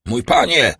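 an affronted banker exclaiming; calling out. A narrator's reading. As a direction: call out sharply in protest. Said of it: "zawołał Danglars".

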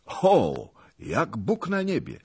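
loud "O", then high-pitched oath; exclaim, leaping up in pitch; astonished exclamation. A narrator's reading. Wołodyjowski hearing where she is, crying out. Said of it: "zakrzyknął Wolodyjowski".

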